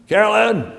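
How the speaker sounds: loud, high-pitched annoyed shout for someone; annoyed call. Retold or imitated. Tired father calls his daughter to dinner, annoyed shout. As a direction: call out sharply with annoyance, well above talking voice. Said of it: "You sounded annoyed".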